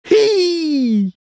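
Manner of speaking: very high long squeal; high-pitched animal squeal; squirrel squeak. A narrator's reading. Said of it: "squittì lo scoiattolo".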